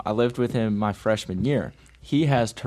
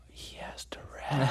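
slow, hushed realisation; horrified whisper; 2 semitones higher, 11 dB quieter than usual. Retold or imitated. Roommate realising his blunder after snapping, horrified whisper. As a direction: whisper it slowly as the mistake sinks in.

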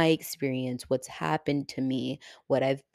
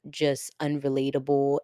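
usual speaking voice

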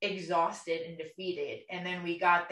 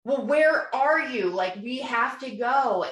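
raised, slow, pleading "where are you"; urgent call; 5 semitones higher, 6 dB louder than usual. Retold or imitated. Pleading with her boyfriend to get to the appointment, high urgent call. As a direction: push it high and loud like you're desperate to leave.

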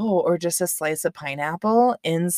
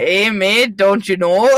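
louder hybrid Minnesota-Aussie accent; Minnesotan-Australian accent; 5 dB louder than usual. Shown as a demonstration. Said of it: "deep Minnesotan accent and the deep Australian accent".

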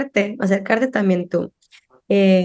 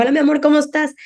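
higher, bright sing-song hello; cheerful mom greeting; 7 semitones higher, faster than usual. Retold or imitated. Mother picking up daughter, cheery high-pitched hello. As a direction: greet your daughter brightly at the school gate. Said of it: "yo paso por mi hija".

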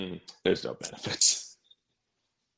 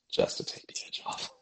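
breathy whispered aside; 8 dB quieter than usual. Real, not acted. Whispering a confession to his cohost. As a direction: let it out as a hushed secret.

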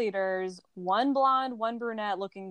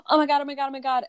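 high, louder panicky "oh my God"; mock panic; 7 semitones higher, 4 dB louder, much faster than usual. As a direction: squeal it in pretend panic. Witches panicking after summoning.

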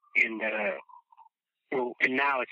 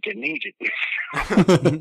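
drawn-out, laughing and louder; laughing, excited; 8 semitones higher, 7 dB louder, much slower than usual. Retold or imitated. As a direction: savour each word through a laugh.

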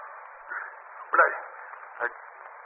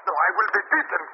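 panicked, higher pleading; frightened pleading; 10 semitones higher than usual. Acted. Terrified caretaker begging the gunman, frightened. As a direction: beg him not to.

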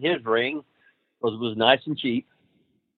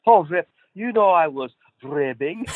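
higher put-on Sheik voice, sheepish; imitating the Sheik; 6 semitones higher than usual. Rip imitates the Sheik backing down to him. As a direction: back down in a funny Sheik voice.

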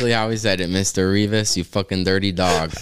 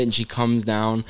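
ordinary talk

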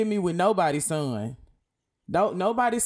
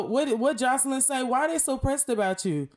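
sassy mock voice; 7 semitones higher than usual. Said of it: "I'm gonna do that as a TikTok".

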